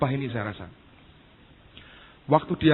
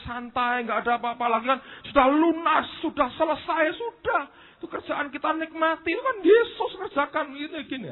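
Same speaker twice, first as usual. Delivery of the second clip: mock lazy believer; 13 semitones higher than usual. Preacher mocking a complacent believer's excuses, high whine. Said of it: "Bantahan orangnya".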